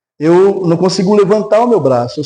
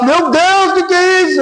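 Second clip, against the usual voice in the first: imitated mother's cry; 13 semitones higher than usual. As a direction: act out a mother crying out to God, pitched way up. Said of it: "a mãe dela começou a gritar lá". (grito agudo de mãe, imitado)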